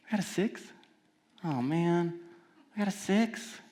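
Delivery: mock disappointed child; deflated kid. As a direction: sag like a let-down kid.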